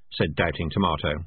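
usual speaking voice

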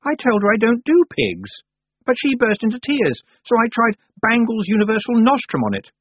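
put-on high, sharp girl's voice; haughty young witch Anagramma. A narrator's reading. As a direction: voice the proud young witch, peevish. Anagramma's voice in dialogue.

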